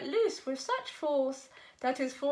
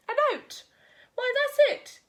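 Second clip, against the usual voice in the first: sudden idea; 10 semitones higher, 5 dB louder than usual. A narrator's reading. Goat gets sudden idea.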